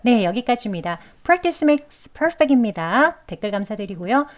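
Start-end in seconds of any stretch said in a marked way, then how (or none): 1.2-3.2 upbeat sign-off. Real, not acted. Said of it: "sign-off slogan".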